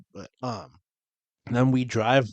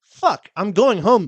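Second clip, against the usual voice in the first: retold yell; 8 semitones higher, 7 dB louder, slower than usual. Retold yell while being followed.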